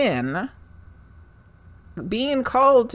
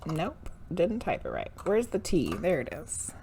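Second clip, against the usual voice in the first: normal talking voice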